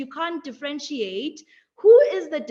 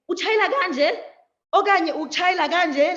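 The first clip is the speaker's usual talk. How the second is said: put-on child puzzling over which way to sweep, louder; confused child asking; 4 semitones higher, 5 dB louder than usual. Child asking how to sweep, puzzled. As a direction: ask it like a lost kid with a broom.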